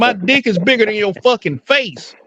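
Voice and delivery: high-pitched, incredulous mock outrage; indignant comeback. Man retells his retort to people he mimicked, high indignant voice.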